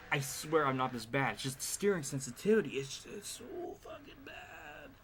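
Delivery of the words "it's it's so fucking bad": high, soft, slow whispered complaint; whispered frustration. Real, not acted. Gamer whispering about the steering. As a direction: let it out as a strained whisper. Frustrated muttering at game controls.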